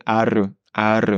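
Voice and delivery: "Ar" with a trilled r; rolled r. Shown as a demonstration. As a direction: roll the r in "ar". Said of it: "à toi chez toi de t'entraîner".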